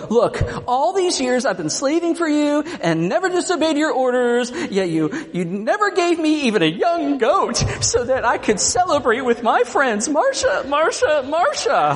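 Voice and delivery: a whiny middle schooler griping to his parent. Acted. Older brother whining to father like a middle schooler. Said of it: "put on the middle schooler in me, the little whiner".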